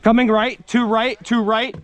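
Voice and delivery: high loud callouts; shouting callouts. Real, not acted. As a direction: bark out positions to the team. Calling enemy positions.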